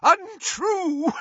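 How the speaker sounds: very high, wheezing protest; strained, wheezy denial; wheezed. A narrator's reading. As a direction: wheeze it out in outrage. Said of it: "wheezed Doge".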